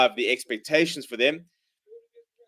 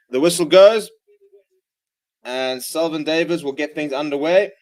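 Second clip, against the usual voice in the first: live play-by-play; 4 semitones higher, 8 dB louder than usual. Acted. Live rugby commentary.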